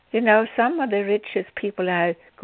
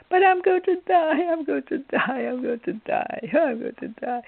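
mock complaining; 6 semitones higher than usual. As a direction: imitate her doom-laden lament, hammed up. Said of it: "she said about everything".

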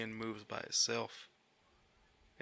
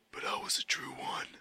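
whisper. Pretend. Whispered confession in scene.

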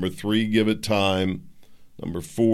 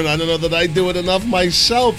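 fired-up sign-off; 9 semitones higher, 6 dB louder than usual. Real, not acted. Closing advice over music.

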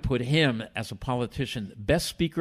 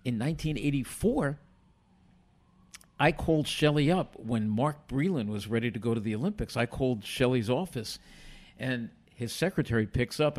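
usual speaking voice

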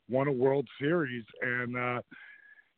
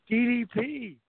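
much higher, thrilled yell of recognition; excited fan shout; 12 semitones higher, slower than usual. Retold or imitated. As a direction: call out his name, then gasp the question slowly. Soldier shouts on recognizing him.

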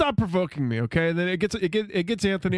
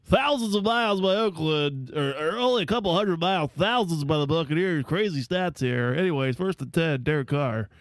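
mock sportscaster. Hyping a silly stat to co-hosts, mock sportscaster. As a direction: put on a bit of a sports announcer. Mocking how a TV crew hypes stats.

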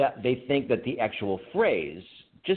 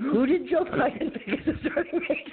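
much higher mock-clueless question; pitched-up bewildered voice on the edge of laughter; imitating confused co-host; 13 semitones higher than usual. Disoriented man questioning the police, high mock-bewildered voice. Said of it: "Poling, forty-six, questioned the police".

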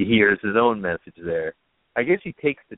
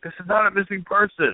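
insistent husband quote; 10 semitones higher than usual. Retold or imitated.

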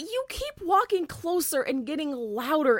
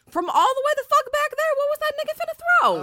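high, loud ridicule; mock her claim in disbelief; incredulous outburst; 11 semitones higher, 7 dB louder, faster than usual. Real, not acted. Ridiculing the customer's claim to the cohost, loud disbelief.

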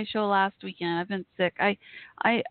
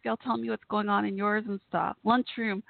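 usual speaking voice

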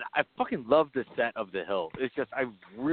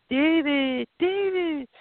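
parents calling; 12 semitones higher, much slower than usual. Retold or imitated. Parents call David back, high pleading call. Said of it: "David's parents show up again".

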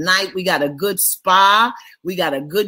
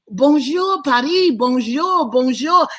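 sing-song French greeting; 8 semitones higher than usual. Real, not acted. Greeting Paris viewers in French.

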